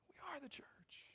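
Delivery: hushed whisper; whispered secret. Real, not acted. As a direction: whisper it to the congregation. Whispers the secret once more.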